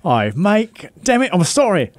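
put-on high, wobbling British-Aussie accent; two muddled accents. Co-host trying accents on his friend, muddled British-Aussie accent. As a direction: slide between two bad put-on accents. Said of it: "See, two different accents".

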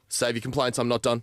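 ordinary talk, nothing marked

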